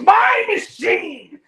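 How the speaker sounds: high, slow, crying shout of my machine; doctor yelling at the interns, slow furious shout. Retold or imitated. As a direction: wail it like a possessive tantrum. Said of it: "He goes".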